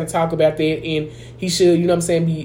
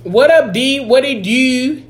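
higher-pitched, drawn-out Baltimore greeting; mock Baltimore accent; 4 semitones higher, slower than usual. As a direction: tease with a put-on Baltimore accent. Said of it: "that is the worst accent".

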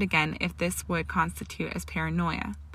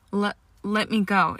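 stumbled, raised-voice protest; sputtering; 3 semitones higher, 4 dB louder than usual. A narrator's reading. Granger struggles as he grips her wrist, sputtering. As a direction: trip on the first word, then protest. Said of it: "she sputtered".

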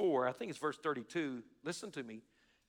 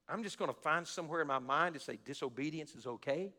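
ordinary talk, nothing marked

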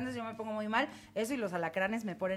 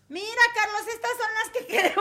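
high, louder, laughing voice; laughing excitement; 12 semitones higher, 6 dB louder than usual. Retold or imitated. Telling Carlos about the rats while bitten, high laughing voice. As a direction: play it cool while a rat hangs off the finger. Rat biting her finger.

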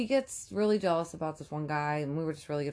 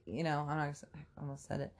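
ordinary talk, nothing marked

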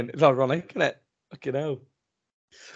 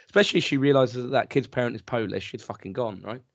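usual speaking voice

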